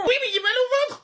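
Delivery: high-pitched pupil's whine; whiny plea. Acted. Pupil begging teacher for the toilet, whiny plea.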